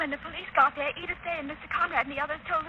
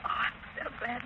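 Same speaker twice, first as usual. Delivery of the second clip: relieved whisper; 3 semitones lower, 5 dB quieter than usual. Acted. Margot relieved Lamont is alive.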